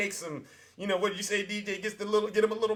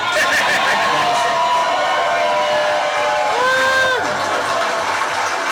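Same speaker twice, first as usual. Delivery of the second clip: a howling laugh, much higher and louder; loud laughing fit; 13 semitones higher, 6 dB louder than usual. Real, not acted. Host cracks up at Trump's clip, howling laugh. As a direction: burst out cackling, can't hold it in.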